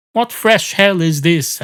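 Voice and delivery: stagey exclamation, stretched out; exasperated maitre d'. Acted. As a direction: draw it out in theatrical despair. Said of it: "And the maitre d' says".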